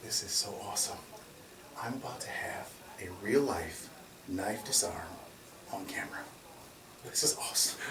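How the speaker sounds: soft, quiet voice full of barely held glee; hushed thrill. Retold or imitated. Cop murmuring to himself about filming the disarm, hushed thrill. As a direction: murmur it like a kid about to get his wish.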